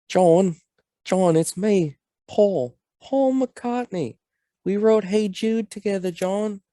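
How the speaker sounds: hammed-up high Liverpool accent. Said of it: "my bad Beatles accent".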